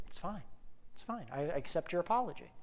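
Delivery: hushed, understated imitation of a child; soft forgiveness. Daughter accepting her dad's apology, softly imitated. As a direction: say it very softly, letting it go.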